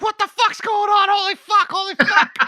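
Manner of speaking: high, frantic mock panic; panicked disbelief. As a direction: squeal it out in shocked confusion. Panicked waking on cruise ship.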